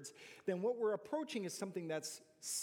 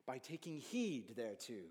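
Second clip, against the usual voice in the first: put-on an Irish accent for an old King James verse. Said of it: "not in that accent".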